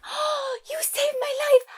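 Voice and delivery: very high, breathless damsel gush; high swooning princess. Acted. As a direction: gasp it like a rescued damsel. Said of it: "the princess is like".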